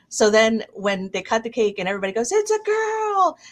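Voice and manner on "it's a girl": put-on high, slow, cheering "it's a girl"; crowd's delighted high call; excited announcement. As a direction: cheer it out like the whole room. Everybody announcing at cake cut.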